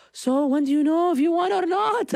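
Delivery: high, edgy dad voice; a hint of a jittery father; anxious dad. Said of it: "My dad was, like, on edge".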